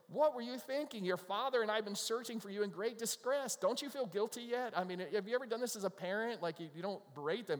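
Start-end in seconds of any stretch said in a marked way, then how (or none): 0.1-4.8 higher, louder mock scolding; scolding mother. Mary scolding the boy Jesus, hammed-up scold. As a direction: scold your runaway boy, pitched up. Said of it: "She's scolding him".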